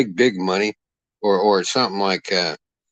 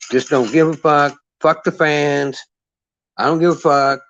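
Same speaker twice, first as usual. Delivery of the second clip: mock careless fighter; 4 semitones higher than usual. Mocking careless fighters' attitude.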